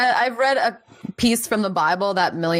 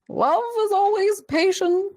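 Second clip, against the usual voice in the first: high, slow mock-solemn scripture voice; sonorous Bible reading; slower than usual. Friend joking on the podcast, mock-solemn scripture reading. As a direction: intone it like a dramatic reading. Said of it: "read off Bible quotes in, like, a very sonorous way".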